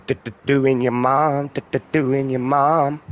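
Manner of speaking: high, loud mocking sing-song; mock clickbait title. Mocking clickbait videos to viewers, a high, loud sing-song. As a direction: parody a cheesy clickbait chant. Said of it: "'cause it doesn't say".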